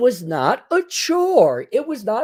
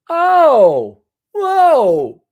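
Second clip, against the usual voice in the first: mock amazement; 7 semitones higher, 7 dB louder than usual. Mock amazement at a nice girl.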